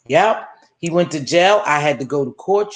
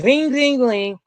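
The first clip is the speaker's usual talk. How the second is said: phone ringing; 5 semitones higher, slower than usual. Retold or imitated. Imitating the computer call ringing.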